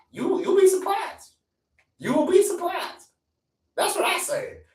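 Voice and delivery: usual speaking voice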